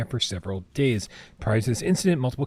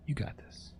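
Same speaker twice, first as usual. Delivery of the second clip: whispered self-encouragement; whispering; 4 semitones higher, 13 dB quieter than usual. Real, not acted. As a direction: breathe it out barely voiced. Self-encouragement during tense gameplay.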